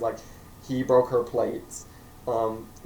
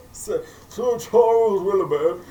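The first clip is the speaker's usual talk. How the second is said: grand announcement; 8 semitones higher, 7 dB louder than usual. Acted. Introducing the butler character grandly, booming. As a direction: announce him like royalty.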